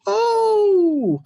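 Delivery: very high, loud hooting Oh; imitated hoot. Imitating Trick hooting after Carmelo's burn, high hoot. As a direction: whoop it like a hype man. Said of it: "Trick was hooting".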